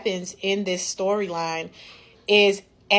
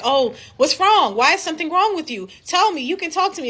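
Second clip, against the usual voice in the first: mock worried pestering; 10 semitones higher, 4 dB louder than usual. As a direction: ham up the smothering worried partner. Mock pestering partner with concern.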